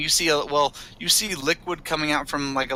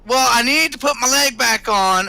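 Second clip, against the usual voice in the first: higher, louder character voice; Jason; 8 semitones higher, 6 dB louder than usual. Acted. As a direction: call it out in character from another room. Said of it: "was from Jason".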